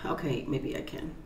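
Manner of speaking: soft unvoiced aside; whispered aside. Real, not acted. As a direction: mutter it off to the side. Aside about eating.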